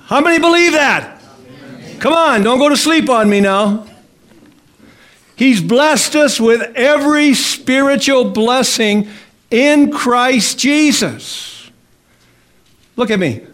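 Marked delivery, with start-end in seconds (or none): 0.1-3.3 calling out. Real, not acted. Preacher rousing a sleepy congregation, calling out. 5.4-11.7 higher, slow, every word weighed; slow preaching emphasis. Acted. Preacher driving home the verse, slow and weighty.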